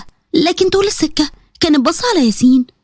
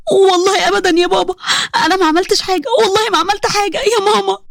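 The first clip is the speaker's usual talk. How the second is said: higher, pleading daughter; frightened pleading; 5 semitones higher than usual. Acted. Daughter swearing to her angry father that she did nothing, in a frightened, higher voice. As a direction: plead your innocence, voice pitched up in fear. Doaa denies to raging father.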